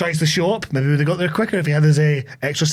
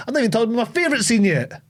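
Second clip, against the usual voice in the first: usual speaking voice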